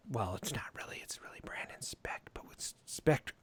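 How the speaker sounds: whispering a joke aside; whispered aside. Real, not acted. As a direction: drop to a whisper. Aside during introductions.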